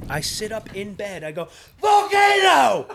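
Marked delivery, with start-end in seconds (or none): 1.8-2.8 startled high yell, drawn out; shout. Retold or imitated. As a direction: jolt awake and yell it. Waking from nightmare, shouting.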